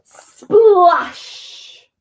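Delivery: sound-effect style word, stretched and loud; big splash exclamation. A narrator's reading. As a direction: burst out with the splash. Climax of the chain reaction.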